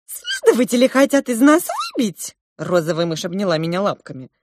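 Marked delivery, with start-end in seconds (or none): 0.1-2.4 high, squeaky mouse voice; alarmed little mouse. A narrator's reading. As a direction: pipe it up like an anxious mouse. Pink mouse reports the interrogations. (тревожный мышиный писк)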